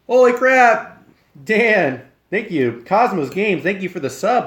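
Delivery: high, loud thrilled outburst; excited surprise. Real, not acted. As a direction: shout out the sub in delight. Surprised by a subscription.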